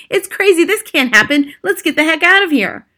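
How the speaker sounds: high, loud shouts of alarm; panicked shouting. A narrator's reading. Diners fleeing a room swarming with mice, panicked shouting.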